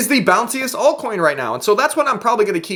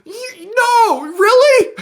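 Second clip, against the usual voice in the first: high, loud mock astonishment; sarcastic disbelief; 5 dB louder, much slower than usual. Real, not acted. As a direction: drip sarcasm at the complainers. Sarcastic reply in rant.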